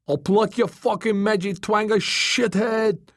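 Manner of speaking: high-pitched, furious retort; angry shout. A narrator's reading. Dangling Joey makes a fist at Tom, angry shout. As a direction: shout the insult back in outrage. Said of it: "he shouted".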